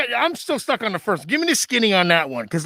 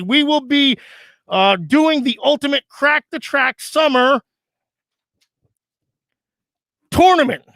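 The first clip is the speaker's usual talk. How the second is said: announcement read; 4 semitones higher than usual. Acted. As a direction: read it out like a bulletin.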